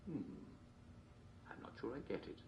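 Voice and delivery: normal talking voice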